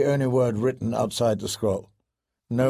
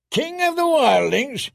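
high, booming incredulous roar; big rough wildling roar; 12 semitones higher than usual. A narrator's reading. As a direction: bellow it in scornful disbelief. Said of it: "Tormund roared".

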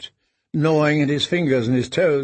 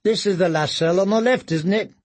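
put-on boy voice; 5 semitones higher than usual. Second boy speaking.

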